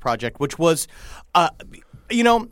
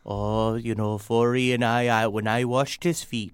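low, soft put-on accent for Joe Mo; a quiet, doting caretaker voice; odd accent impression; 6 semitones lower, 4 dB quieter than usual. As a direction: murmur it in a strange unplaceable accent. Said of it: "it was a good impression".

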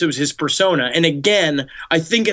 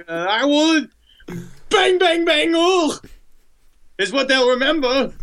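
much higher, hammed-up killer caricature; Son of Sam; 8 semitones higher than usual. Killer imagining his shooting, high cartoon voice. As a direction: play it as a silly high-voiced crook. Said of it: "I'm Son of Sam".

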